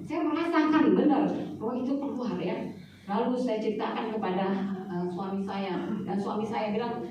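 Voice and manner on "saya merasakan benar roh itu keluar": normal talking voice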